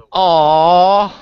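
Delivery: loud, high, let-down "aw"; disappointed aw. Acted. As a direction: groan it out like a sulking kid. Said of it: "And this is where I go".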